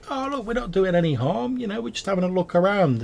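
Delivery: high squeaky goblin protest. Acted. Goblins pleading innocence to the dwarf, high and quick. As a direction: pitch way up, whiny and fast. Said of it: "The goblins are like".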